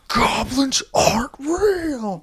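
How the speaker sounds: very high, loud, slow wail; mock hysterical yell. Father raging at son over goblins, mock hysterical yell. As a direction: scream it out, dragging each word. Acted dad yelling at his son.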